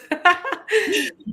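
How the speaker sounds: a burst of high giggling laughter; high excited laugh. Real, not acted. Host laughing with delight at the big announcement, high giggle. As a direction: laugh out, thrilled and bubbling over.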